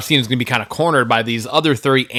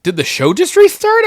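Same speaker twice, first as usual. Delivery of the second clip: confused disbelief. Retold or imitated. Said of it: "and I was like".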